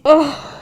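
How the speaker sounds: a loud, high, overwhelmed groan; pained groan. Real, not acted. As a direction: groan like the song just got you. Song hits, she feels emotional.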